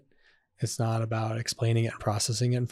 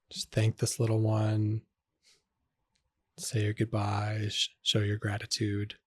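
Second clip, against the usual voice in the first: guided meditation; 4 dB quieter than usual. Acted. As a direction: ease the listener gently back into the room.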